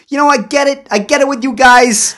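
high-pitched Boston comic impression; Boston accent. Comedian addressing the nerd podcasters, mock Boston accent. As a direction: imitate a pushy guy in thick Boston accent. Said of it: "he's got this thick Boston accent".

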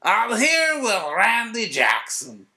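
booming, drawn-out announcer opening; Buck Martinez. Acted. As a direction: stretch it out like a big TV intro. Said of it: "I'll interview you as Buck Martinez".

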